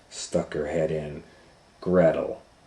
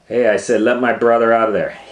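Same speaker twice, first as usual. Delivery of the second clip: high, loud, fast insistence; furious repeated demand; angry shout; 5 semitones higher, 11 dB louder, faster than usual. Acted. Gretel demands again.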